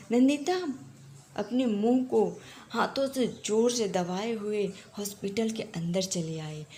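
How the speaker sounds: usual speaking voice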